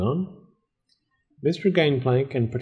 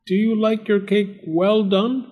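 high-pitched called question; raised storybook question, pitched up; calling out; 10 semitones higher than usual. A narrator's reading. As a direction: call from a smoky kitchen. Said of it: "Mister Gangplank called".

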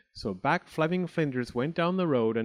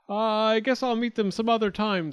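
high, louder hasty excuse; nervous boy voice; 10 semitones higher, 4 dB louder than usual. Acted. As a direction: bluff your way out. Boy hurries away nervously.

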